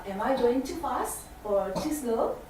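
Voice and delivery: normal talking voice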